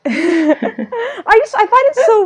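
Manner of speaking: high, helpless laughter through the words; laughing. Real, not acted. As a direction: crack up while trying to speak. Cracks up over the nickname.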